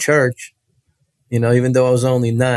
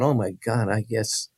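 much softer, unsure self-talk; hushed inner thought; 8 dB quieter than usual. Retold or imitated. Boy doubting himself inside, hushed and unsure. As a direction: think it to yourself, doubting. Frightened boy's private thought.

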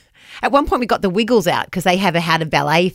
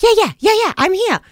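eager mock reply; 12 semitones higher than usual. Host imitating the overkeen mum's eager yeahs. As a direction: gush like the keen early mum. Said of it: "she was like".

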